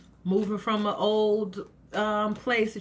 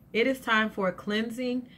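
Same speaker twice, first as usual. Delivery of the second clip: ordinary talk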